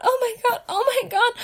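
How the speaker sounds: ordinary talk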